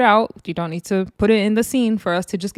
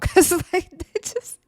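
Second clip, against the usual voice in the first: squeaky high words breaking into real laughter; laughing; 11 semitones higher than usual. Real, not acted. Justifying her dark thought, really laughing. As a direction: try to talk through giggles.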